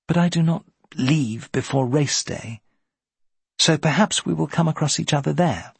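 hint of a woman making polite conversation; lightly voiced niece. Niece telling the captain when she leaves, lightly voiced.

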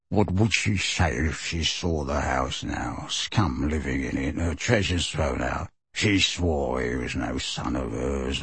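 low, soft, slow elf grumble; muttered resentment. A narrator's reading. The elf leaving the room, low bitter mutter. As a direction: mutter it low as you shuffle out. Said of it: "he muttered all the way".

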